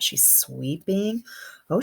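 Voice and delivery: usual speaking voice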